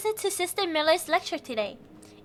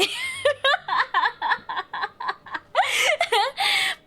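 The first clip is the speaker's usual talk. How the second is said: high, long laughing fit; long giggle fit; 8 semitones higher than usual. Real, not acted. Streamer laughing at her own French accent. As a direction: laugh helplessly at the failed accent.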